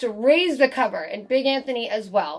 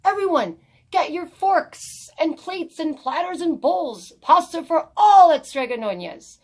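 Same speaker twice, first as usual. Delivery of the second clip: put-on boy calling the townspeople to eat, raised voice; shouting to the town; 7 semitones higher, 5 dB louder than usual. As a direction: call out to the whole square from the fountain. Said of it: "jumped on the fountain and shouted".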